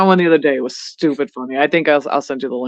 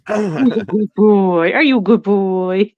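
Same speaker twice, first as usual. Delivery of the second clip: drawn-out, higher cooing; dog-praising coo; 4 semitones higher, slower than usual. Pretend. Praising a good boy, playful pet-talk coo.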